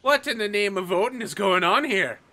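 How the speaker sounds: a light Irish lilt for the Icelandic chieftain Heidi, baffled; Irish accent. Acted. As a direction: voice Heidi with an Irish accent, rising as she asks about Thor. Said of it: "apparently I turned Irish this episode".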